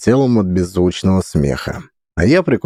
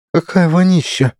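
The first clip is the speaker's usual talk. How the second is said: disgusted exclamation; 10 semitones higher than usual. Acted. Zinka voiced.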